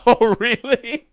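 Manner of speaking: high, loud and slow, fighting a laugh; reacting to the masseuse's warning, stifled laugh; holding back laughter. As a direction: say it while trying not to laugh. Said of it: "I try not to laugh".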